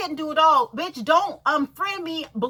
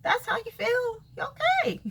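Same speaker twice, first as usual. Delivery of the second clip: 1 mock sweet dismissal; 9 semitones higher, 5 dB quieter than usual. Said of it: "And I was like".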